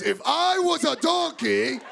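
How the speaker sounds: slow, high, indignant repetition; irritated repeat. Retold or imitated. Irritated repeat after being questioned.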